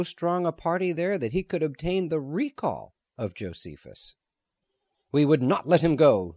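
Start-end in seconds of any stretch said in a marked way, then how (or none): 5.1-6.3 louder, heated protest; hotly. A narrator's reading. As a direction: fire it back hotly. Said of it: "Solomon said hotly".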